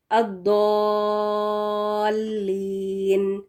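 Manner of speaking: الضالین with a heavy "Daw" and very long drawn-out vowels; heavy Daad. Shown as a demonstration. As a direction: stretch الضالین slowly as in recitation, Daad thick. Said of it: "جو داد کے ساتھ شروع ہوتا ہے".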